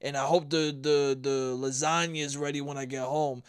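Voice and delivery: dumb Italian husband. Retold or imitated. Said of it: "if he's that much of a moron, right, where he's like".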